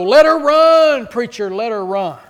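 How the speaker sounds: loud, slow shout from the pews; yelled with gusto. Retold or imitated. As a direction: belt it out from the back. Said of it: "yelled with gusto".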